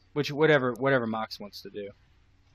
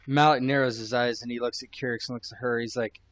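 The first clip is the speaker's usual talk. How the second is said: ordinary talk, nothing marked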